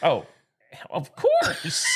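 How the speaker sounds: usual speaking voice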